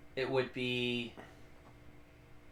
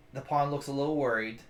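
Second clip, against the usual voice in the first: normal talking voice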